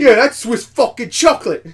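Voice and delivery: high, loud put-on character voice; cursing trailer character. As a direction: imitate a brash cartoon character.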